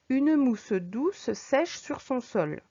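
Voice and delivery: very slow, "mousse douce" drawn out on the S; slow soft reading. Shown as a demonstration. Teacher reads the example slowly to show the soft S. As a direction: let the S sounds glide softly. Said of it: "allitération avec un son en S".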